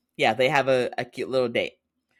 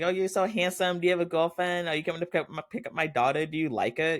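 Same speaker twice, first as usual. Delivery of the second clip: higher, gushing mock mom voice; 8 semitones higher, faster than usual.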